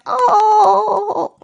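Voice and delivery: high, loud hurt moan; pained moan. Pretend. Dickless man on the ground, moaning in pain. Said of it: "the man, like, being like".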